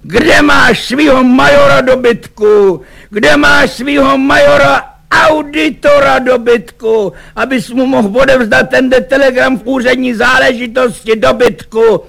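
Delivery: high, loud raging roar; bellowing. A narrator's reading. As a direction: roar the abuse while beating a servant. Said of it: "přitom řval".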